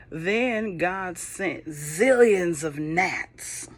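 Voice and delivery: ordinary delivery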